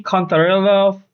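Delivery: ordinary talk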